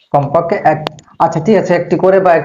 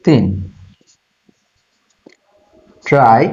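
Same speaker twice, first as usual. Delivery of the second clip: list dictation; 4 semitones lower, slower than usual. Real, not acted. Teacher listing four syllable types for students, slow dictation. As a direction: lower voice, item by item with long pauses.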